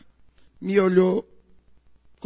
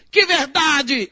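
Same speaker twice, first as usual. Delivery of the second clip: sharp, higher, defiant "Que verdade?"; retold angry shout; 6 semitones higher, 4 dB louder, much slower than usual. The son defying the visiting neighbour, retold as a shout. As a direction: snap back defiantly. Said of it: "Não grite comigo".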